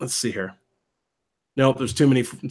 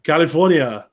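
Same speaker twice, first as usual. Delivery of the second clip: Arnold. Acted. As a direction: draw it out in an Austrian accent. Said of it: "Arnold California".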